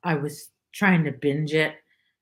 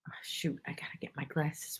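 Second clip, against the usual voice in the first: breathy unvoiced aside under her breath; whisper to herself; 13 dB quieter than usual. Real, not acted. Realising her glasses are missing, whispered to herself. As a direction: mutter it to yourself, barely audible.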